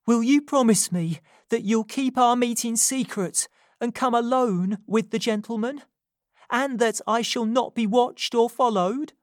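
urgent Nancy. A narrator's reading. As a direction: confess it as if your life depends on it. Nancy demanding promise.

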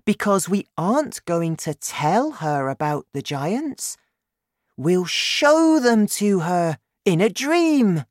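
put-on high, eager child's voice; insistent girl. A narrator's reading. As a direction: explain the plan high and keenly, as a girl. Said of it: "cried Sophie".

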